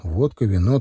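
usual speaking voice

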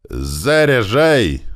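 loud, high, long-drawn military command; bellowed order. A narrator's reading. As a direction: bellow it slowly to the gun crew. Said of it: "заорал прапорщик". (протяжный командный крик)

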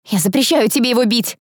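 high, urgent prohibition; sudden exclamation. A narrator's reading. Girl stops Tom hitting the gentleman, exclaiming. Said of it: "вдруг воскликнула Этери".

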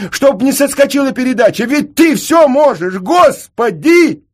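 very high, loud, desperate prayer; frantic plea. A narrator's reading. Senka begs God to keep the motor running, high frantic shouting. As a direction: beg at the top of your voice, stretching the last cry.

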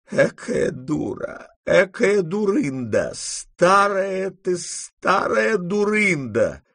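high, very slow scolding of herself; self-reproach. A narrator's reading. Grandmother scolds herself on the way back, slow and high. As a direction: berate yourself, drawn out. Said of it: "начали вырываться восклицания".

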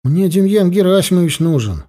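mild, high-pitched narrated yell. A narrator's reading. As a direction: insist urgently on seeing the doctor. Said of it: "Что орёшь?".

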